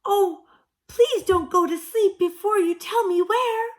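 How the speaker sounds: high, loud begging; pleading. A narrator's reading. Katy begging the sleepy owl.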